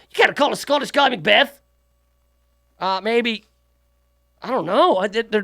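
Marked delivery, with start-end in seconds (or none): 0.1-1.6 higher put-on Scottish voice; exaggerated mock Scottish accent. Riffing on insults for a Scottish guy, in Scottish accent. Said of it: "that's the only Scottish accent I can do".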